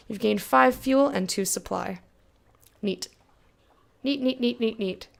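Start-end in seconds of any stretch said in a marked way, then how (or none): none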